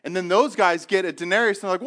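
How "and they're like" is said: usual speaking voice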